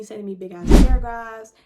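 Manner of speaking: sudden loud burst on paragraphs; loud exasperated emphasis; outraged emphasis. Real, not acted. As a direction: blast it out in disbelief. Outraged at his long texts.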